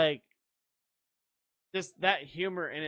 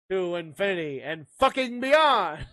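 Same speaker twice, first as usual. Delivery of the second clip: mock-heroic catchphrase, louder toward the end; Toy Story catchphrase; 2 semitones higher, 5 dB louder than usual. Joker quoting Toy Story with a swear, mock-heroic. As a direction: belt out the hero's line with a swear. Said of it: "if you could say Toy Story".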